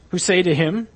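usual speaking voice

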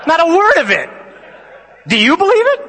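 high, loud mock-incredulous shouting; yelled out. Seminarians heckling a classmate's reading, loud mock yell. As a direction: heckle at the top of your voice. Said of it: "we all yelled out".

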